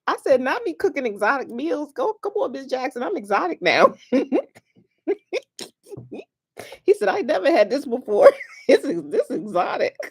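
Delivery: high, giggly retelling; tickled, cracking up. Real, not acted. Retelling the exotic zucchini exchange, still laughing helplessly. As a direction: squeeze the words out high between real laughs. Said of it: "I just had to get that out".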